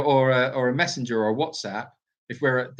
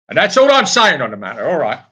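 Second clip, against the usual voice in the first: mock stern finish; 11 dB louder than usual. Mock-stern close after refusal.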